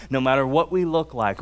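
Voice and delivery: usual speaking voice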